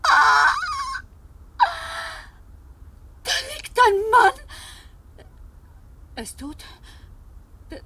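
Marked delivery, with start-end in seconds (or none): 0.0-4.3 loud, high startled cry; horrified shout on spotting a corpse; shocked shout. Acted. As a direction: cry out at finding a body. Discovers body.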